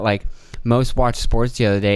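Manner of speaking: ordinary delivery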